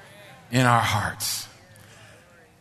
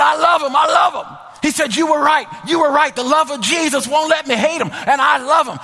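overjoyed; 10 semitones higher, 4 dB louder than usual. Retold or imitated. He started crying.

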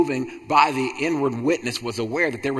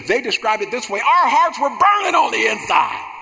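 rising pulpit crescendo to a high shout; fervent preacher, pitch climbing steadily to a shouted finish; impassioned preaching; 7 semitones higher than usual. Real, not acted. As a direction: build through the repetitions, then cry out the last line. Preaching builds to shouted quote.

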